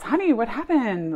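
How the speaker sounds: dad stunned at the empty lot; surprised dad. Retold or imitated. Dad pulling up to an empty lot, calling out in alarm. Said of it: "um, says".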